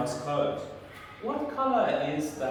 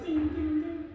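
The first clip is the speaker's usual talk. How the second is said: playful ding ding; 12 semitones higher than usual. Pretend. Playful hint sound for child.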